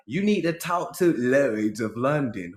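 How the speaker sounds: imitating Southern slipping into British; Southern accent disappearing. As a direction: let the Southern accent slip toward British. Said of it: "his Southern accent kinda disappeared".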